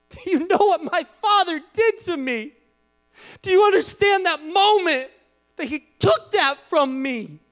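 broken, pleading prayer of hurt; anguished plea to God. Acted. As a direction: pour out a wounded heart to God. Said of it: "picture us looking at God and saying".

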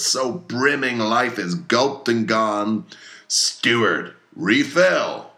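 put-on a fierce old sea captain rallying his crew; commanding, hammy captain with bursts of loud toasts; Captain Ahab voiced. Ahab to his mates and harpooneers, commanding and fierce.

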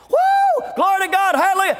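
high-pitched revival whoop. Retold or imitated. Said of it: "redneck got to shouting".

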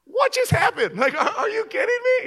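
high, laughing mock voice of a coworker. Coworker laughing at the fainted pastor, high mocking voice. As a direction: laugh through the words at him.

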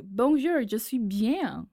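high, punched French with Southern twang; hammed-up Southern-accented French; double Southern accent. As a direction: speak French like a Southerner, jokingly. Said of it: "double Southern accent".

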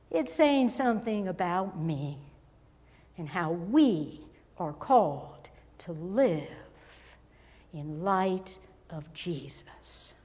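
ordinary delivery

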